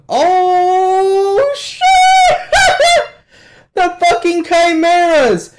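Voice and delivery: drawn-out, very high, loud shriek of delight; ecstatic scream. Real, not acted. Viewer thrilled the Chimeras showed up, ecstatic screaming. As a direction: scream it out and break into a laugh.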